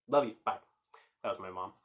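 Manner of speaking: ordinary delivery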